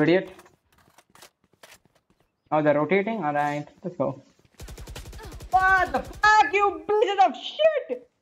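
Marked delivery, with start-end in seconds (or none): 5.5-8.1 drawn-out angry yell. Real, not acted. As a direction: drag out the rage at the enemies. Raging at enemies in game.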